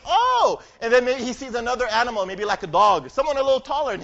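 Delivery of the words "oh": amazed oh. Acted. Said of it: "and he goes".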